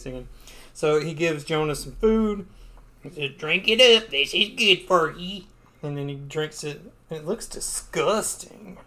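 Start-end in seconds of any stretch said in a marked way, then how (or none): 3.4-5.4 higher nerdy guy voice. Acted. Nerdy guy offers food.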